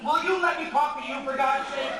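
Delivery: loud exasperated demand; exasperated outburst. Acted. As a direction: snap at them to let you talk. Pleading with imagined women.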